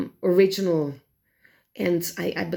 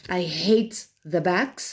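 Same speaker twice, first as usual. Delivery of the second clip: ordinary talk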